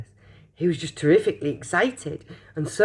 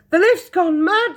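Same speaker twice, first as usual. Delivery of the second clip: much higher, louder cry; alarmed shout; 13 semitones higher, 7 dB louder than usual. Acted. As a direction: shout in panic. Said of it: "shouted Grandpa Joe".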